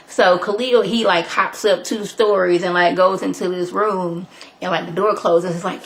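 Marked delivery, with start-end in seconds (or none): none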